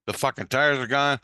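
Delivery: ordinary talk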